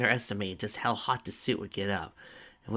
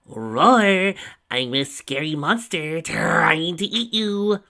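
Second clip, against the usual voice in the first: high, loud, slow mock monster threat; monster yell; 8 semitones higher, 10 dB louder, much slower than usual. A narrator's reading. Tracy yells at Twilight to scare her, hammed-up monster voice. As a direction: bellow it like a cartoon monster. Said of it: "Tracy yelled".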